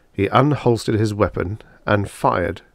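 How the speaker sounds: ordinary delivery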